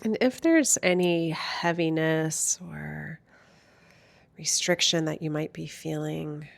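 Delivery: hushed, soothing lead-in to stillness; guided meditation. Real, not acted. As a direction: gently guide listeners inward, unhurried and calm.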